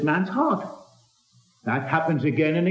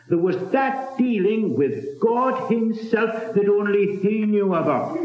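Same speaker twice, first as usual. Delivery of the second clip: ordinary talk, nothing marked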